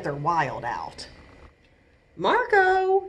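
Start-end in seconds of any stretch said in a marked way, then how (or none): 2.2-3.1 higher, louder calling out. Acted. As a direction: call him from another room. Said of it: "his mom called".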